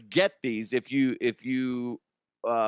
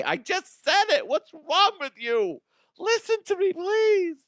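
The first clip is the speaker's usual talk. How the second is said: much higher, louder put-on nagging voice; mock scolding voice; 3 dB louder than usual. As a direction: scold yourself in a squeaky character voice. Scolding himself in falsetto voice.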